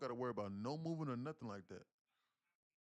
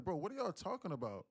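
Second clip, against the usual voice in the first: loud, higher retold yell at family; 6 semitones higher, 4 dB louder than usual. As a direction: yell at them, a bit hammed. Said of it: "I had to yell at them".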